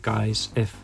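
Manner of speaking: usual speaking voice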